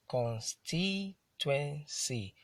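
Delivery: "Constituency" stretched into four spaced beats; syllable by syllable. Shown as a demonstration. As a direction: count out "Constituency" slowly, one syllable at a time. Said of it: "That's a four-syllable word".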